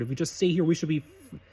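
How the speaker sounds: ordinary delivery, nothing marked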